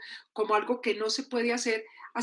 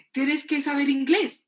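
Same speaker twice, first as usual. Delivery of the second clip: higher, slow, emphatic order; insistent demand; 4 semitones higher, 4 dB louder than usual. Acted. Everyone expects it of us, insistent slow demand. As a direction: press it slowly, rising at the end. Expectation, then compliance ticked off.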